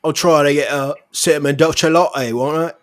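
loud, high Cockney-style "Oi"; hammed-up Cockney accent ordering coffee. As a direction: bark it out like a rough London bloke. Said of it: "a lower class of Britain".

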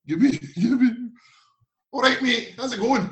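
high, loud broad Glasgow greeting; Scottish accent. Retold or imitated. Teammate Andy Gibson greeting the newcomer in broad Scots. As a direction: imitate a thick Scottish hello.

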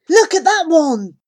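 high, loud excited shout; excited call. A narrator's reading. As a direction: point and call out eagerly. Jeevan excited, pointing.

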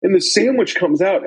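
ordinary delivery

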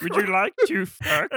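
high-pitched cartoon alien voice; comic little spaceman impression; Marvin the Martian. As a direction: do a silly cartoon Martian, half laughing. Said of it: "they kinda sound like Marvin the Martian".

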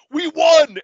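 high, loud mock victory shout; triumphant yell. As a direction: shout it like a cheesy hero holding up a sword. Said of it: "fucking Jody goes".

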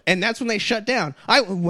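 usual speaking voice

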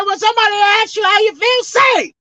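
coach's shout, the last word loudest; pastor shouting. Retold or imitated. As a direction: drill the congregation's answer. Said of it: "He said".

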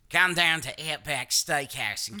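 hammed-up Australian steakhouse pitch; Outback ad Australian. Joking about Australians, mock Outback commercial accent. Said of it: "I think of the Outback guy".

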